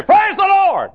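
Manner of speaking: shouted high and loud, clapping-church joy; excited praise. Retold or imitated. Worshippers in heaven shouting praise, excited. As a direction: cry it out like a happy congregation.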